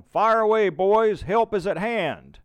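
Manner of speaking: high, loud officer's rallying shout; yelled encouragement. A narrator's reading. Colonel to militia under fire, yelling down. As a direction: call down to rally the men. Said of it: "Lieutenant Colonel William Polk yells down".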